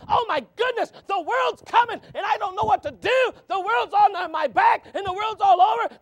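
high, loud frantic cry; mock panic, shouted high and loud; panicked shout. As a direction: wail it like the world is crashing in. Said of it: "running around going".

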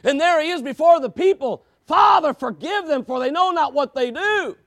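loud, rising preacher's call; fiery pulpit preaching; preaching at full force. Acted. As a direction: build the questions to a shout.

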